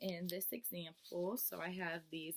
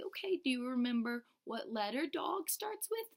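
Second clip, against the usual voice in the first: a touch of the bright teacher voice; teacher prompting Susie, lightly bright; 7 semitones higher, 3 dB louder than usual. Shown as a demonstration. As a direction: coax the child, lifted. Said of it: "I'll say".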